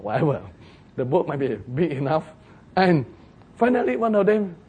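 normal talking voice

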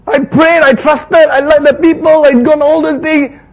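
high, loud aggrieved protest; indignant Moses. Acted. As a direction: protest that you did everything right. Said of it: "It's almost like".

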